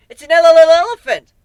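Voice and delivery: high, loud, giddy stutter; unhinged glee spotting an elephant; manic excited stammer. Acted. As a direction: blurt it out like a thrilled child.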